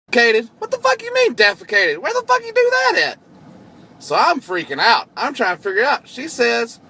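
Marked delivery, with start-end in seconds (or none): 0.1-3.2 high, shocked, disbelieving cry of outrage. Real, not acted. Boss hearing his driver defecated, shocked outrage. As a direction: react as if the news is unbelievable.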